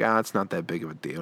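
retold low-key shrug-off; dismissive. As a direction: play it down casually, voice dropped. Playing down the dent to angry dad.

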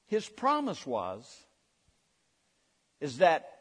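ordinary talk, nothing marked

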